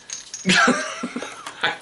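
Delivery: high-pitched laugh. Real, not acted. Host laughing at own fumble with the bases, high laugh.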